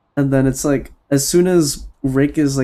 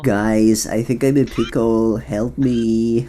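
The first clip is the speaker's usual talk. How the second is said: TikTok voice; 4 semitones lower, slower than usual. Acted. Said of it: "It's like the TikTok voice".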